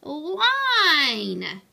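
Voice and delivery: loud, high protest, slowing to drawn-out words; angry snarl. A narrator's reading. Hector objects to the flat ramp, outraged snarl. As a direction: protest loudly, stretching the final words. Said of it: "Hector snarled".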